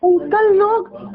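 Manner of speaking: loud, high and very slow "uncle"; drawn-out plea. Real, not acted. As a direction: stretch it out, pleading and upset. Upset wife pleading with uncle.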